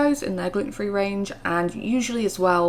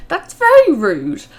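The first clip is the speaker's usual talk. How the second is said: louder, slow indignant remark; 8 semitones higher, 9 dB louder than usual. Real, not acted. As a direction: say it slowly, genuinely offended. Indignant at rude server.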